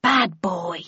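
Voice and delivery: a scolding word to the pet; mild scolding of a naughty pet. Acted. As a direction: tell the animal off. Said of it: "she added in a scolding tone".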